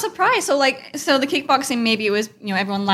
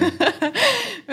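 laughing; 10 semitones higher than usual. Real, not acted. Host laughs at joke.

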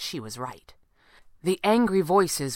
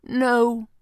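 short, higher and louder denial; simple, firm no; 8 semitones higher, 7 dB louder than usual. A narrator's reading. Falcone answers after considering, simple and firm. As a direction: state it simply, no argument. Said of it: "he said simply".

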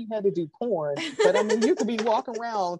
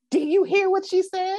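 scandalised; 10 semitones higher than usual. Retold or imitated. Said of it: "Somebody gonna say".

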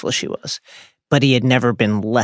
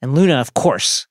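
normal talking voice

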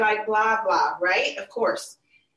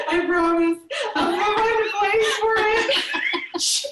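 laughing promise; 9 semitones higher, slower than usual. Retold or imitated.

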